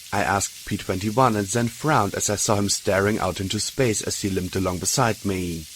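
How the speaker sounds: plain reading voice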